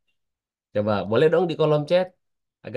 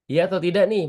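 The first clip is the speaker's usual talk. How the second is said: ordinary delivery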